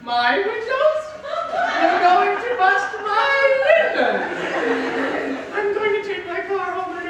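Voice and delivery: high, slow, aghast; rising horror over his car; car owner dismayed his windows will be smashed, pitched high and drawn out; alarmed disbelief. Acted.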